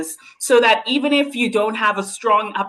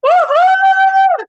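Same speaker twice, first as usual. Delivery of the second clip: long, high, loud cheer; celebratory whoop; 10 dB louder than usual. Real, not acted. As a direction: whoop loudly on one held high note. Host cheers after the speech.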